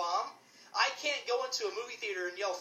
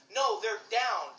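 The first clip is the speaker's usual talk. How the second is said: slow emphatic; much slower than usual. Real, not acted. Emphatic rebuttal.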